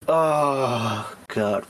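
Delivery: normal talking voice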